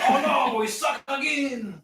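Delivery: high, drawn-out mock Creole accent. Shown as a demonstration. Showing a buddy the catchphrase, exaggerated Creole accent. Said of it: "now that sounds Creole".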